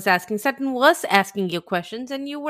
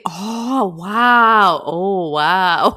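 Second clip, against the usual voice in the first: mock gasping wow; 5 semitones lower, 3 dB louder, much slower than usual. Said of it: "gasping and saying".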